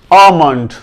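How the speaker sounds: आमंड opening "aa-m", no l; long vowel straight into m in आमंड; silent l. Shown as a demonstration. Teacher models the proper reading, silent l. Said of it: "correct pronunciation".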